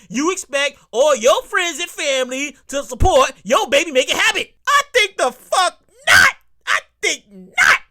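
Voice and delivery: loud, emphatic indignant rant; worked up. Real, not acted. Comic refusing to pay for friends' babies, worked up. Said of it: "I get a little excited".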